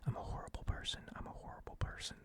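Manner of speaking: whispered self-reproach; guilty whisper. Real, not acted. Vlogger whispering guilt after calling him a gnome. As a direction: breathe it out, ashamed. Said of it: "I feel horrible saying that".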